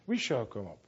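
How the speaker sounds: ordinary talk, nothing marked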